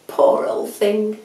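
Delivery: ordinary delivery, nothing marked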